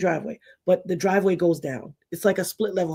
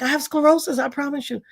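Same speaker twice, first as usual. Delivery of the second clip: higher insistent plea; pleading child; 8 semitones higher than usual. Retold or imitated. Girl pleads with her mother, higher voice. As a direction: beg to be believed.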